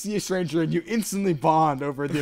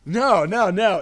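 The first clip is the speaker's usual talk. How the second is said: louder, higher flustered denials; loud protest; 5 semitones higher, 8 dB louder, much slower than usual. Retold or imitated. Guy in the frat house insisting he is not wrong, loud protest. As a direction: protest hard, a little higher. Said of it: "he's like".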